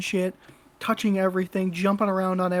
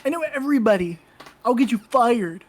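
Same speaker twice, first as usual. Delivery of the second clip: higher, whiny mocking of a smug entitled man; entitled little man; 5 semitones higher than usual. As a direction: sneer it like a pompous little man who thinks he owns the place. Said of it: "doing that bald, tiny man thing".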